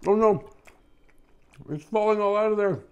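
high, loud mock alarm; excited alarm. Real, not acted. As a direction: sound the alarm, all excited. Lobster falls out of sandwich.

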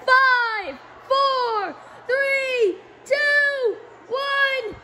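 high excited counting down; new year countdown crowd. Real, not acted.

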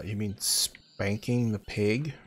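ordinary delivery

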